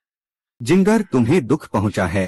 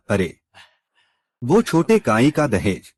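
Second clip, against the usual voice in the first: usual speaking voice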